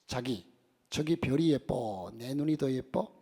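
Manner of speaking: a slow, lightly voiced coy wife; Seoul wife. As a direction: coax the husband under the stars. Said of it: "서울 부부의 아내가 남편에게". (느리고 애교 섞인 서울 아내 말투)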